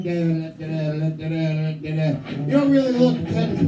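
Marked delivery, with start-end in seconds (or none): 0.0-2.2 low, droning da da da; stoned mindless swiping. Retold or imitated. Stoned guy swiping on Tinder, with a low zoned-out drone. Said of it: "you're just kinda like".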